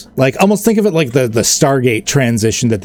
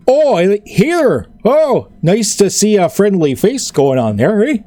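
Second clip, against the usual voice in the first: higher, cheery Canadian-ish orc greeting; friendly orc voice; 7 semitones higher than usual. Acted. GM voices the orc greeting Royale with Cheese, cheery and higher. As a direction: greet the stranger like a friendly neighbour. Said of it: "he looks at you and goes".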